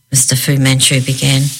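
usual speaking voice

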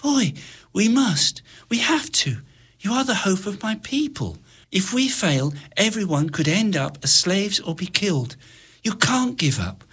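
hushed, pressing whisper; girl whispering a plea; urgent whisper. Acted. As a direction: whisper so the guards can't hear.

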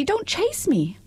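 high, frightened repeat with Don't stressed; frightened little voice. Acted. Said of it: "such a frightened little voice".

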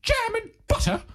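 very high-pitched put-on voice; prim tearoom customer. Customer orders a scone with jam, in a very high put-on voice. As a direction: play a squeaky, polite customer ordering.

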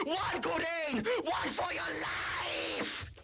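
high yell, last words stretched out long; urgent warning cry. Acted. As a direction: cry out a drawn-out warning to flee. Toy urges friend to flee.

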